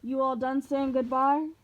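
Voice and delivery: menacing god voice; Ares taunt. Acted. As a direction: sneer it like a cocky war god.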